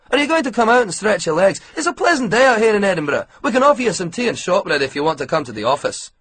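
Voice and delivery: chatty Scottish mechanic offering tea and shortbread; bright, hurried Scottish hospitality; Scottish accent. A narrator's reading. Scottish ground worker talking.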